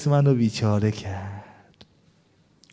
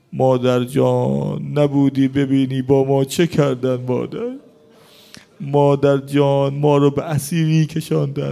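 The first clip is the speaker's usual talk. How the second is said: louder, rising lament preaching; mourning sermon climax; 3 semitones higher, 4 dB louder than usual. Acted. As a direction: cry the story out to the mourners. Mourning preaching, voicing Zainab and Fatima.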